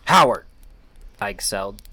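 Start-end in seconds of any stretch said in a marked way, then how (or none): 0.0-0.5 louder, relieved cry of his name; wife reaching her husband in a panic; urgent call. A narrator's reading.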